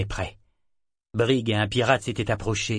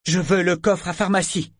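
demanding; 8 semitones higher, 5 dB louder than usual. Acted. Eta demands the chest.

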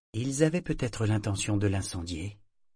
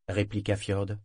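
ordinary delivery, nothing marked

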